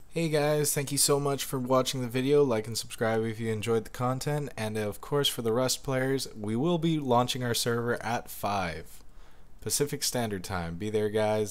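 outro read. Acted.

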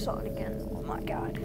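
soft whispered gasp; scared whisper. Real, not acted. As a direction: whisper it in dread. Hiding from monster.

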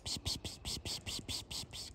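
rapid, soft, voiceless "psst" calls; cat-calling hiss. Real, not acted. Woman luring kittens to look at her, cat-calling psst. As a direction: coax a shy cat with little hisses.

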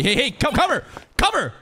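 high, urgent shouted warning; urgent calling out. Real, not acted. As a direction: call out sharply to get someone to safety. Calling out to character in danger.

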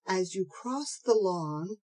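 ordinary delivery

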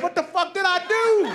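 very high exasperated wail; high-pitched mock outrage; indignant protest. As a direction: protest loudly in an acted quarrel. Acted quarrel, man protesting.

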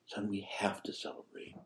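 soft whisper, tender; father whispering his plea to the sulking son. Retold or imitated. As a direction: breathe it gently to the son. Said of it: "he says again".

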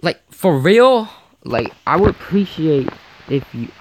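loud incredulous for real; indignant outburst. Real, not acted. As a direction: throw it out in disbelief. Repeated, indignant build.

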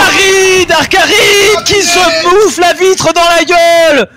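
shouting play-by-play. Acted. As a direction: scream the winner like a sportscaster.

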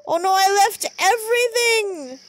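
high-pitched mock-distressed cry; high, dramatic dismay; dismayed wail. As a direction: wail over a game mishap. Crash, belongings lost.

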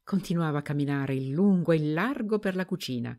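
usual speaking voice

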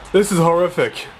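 ordinary delivery

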